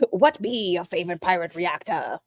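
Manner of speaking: bad pirate accent. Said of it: "God, that's so bad".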